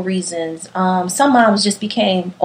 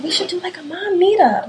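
friend suggesting; 9 semitones higher than usual. Retold or imitated. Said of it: "my girl was like".

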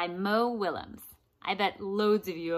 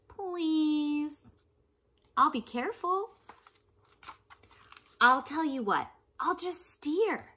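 higher, wheedling pigeon voice; pleading pigeon; 6 semitones higher, 4 dB louder than usual. Acted. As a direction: beg like a pushy little bird. Pigeon voiced through the book.

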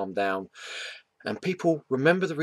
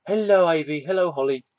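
high sing-song hello; raised, doting little-ones voice; greeting the pets; 7 semitones higher, 5 dB louder than usual. Real, not acted. Greets the girls off camera.

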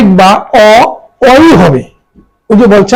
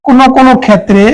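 usual speaking voice

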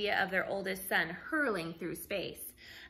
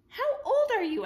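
much higher, louder woman's voice; counter woman voice; 13 semitones higher, 7 dB louder than usual. Acted. Woman behind the counter asks the boy, high bright voice. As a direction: lift into a bright high lady voice.

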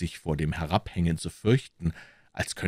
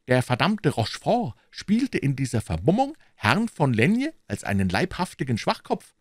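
higher, eager gossip voice; a musketeer spreading juicy news; 11 semitones higher than usual. A narrator's reading. Musketeer tells the crowd the news, eager gossip.

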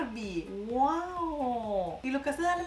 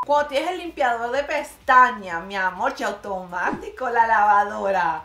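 loud; 10 dB louder than usual. Real, not acted. Enthusing about lash cleaner.

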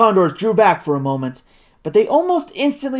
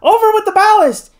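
high, loud emergency command; urgent high shout to the crew; shouted order; 6 dB louder than usual. A narrator's reading. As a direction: shout it high, as the balloon drops. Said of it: "he shouted".

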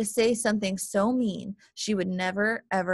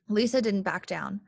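ordinary delivery

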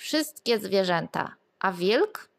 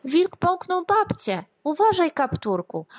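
animals calling out; 8 semitones higher, 3 dB louder than usual. A narrator's reading. Forest animals warn the girl, calling out high and loud. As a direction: call out in alarm to the girl. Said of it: "wołały leśne zwierzęta".

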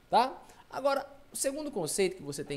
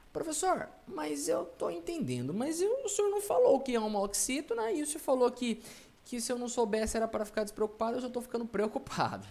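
lightly voiced anxious pupil, pitched up; mock student protest in a higher voice; worried student; 6 semitones higher than usual. Student interrupts the teacher with a worry, worried.

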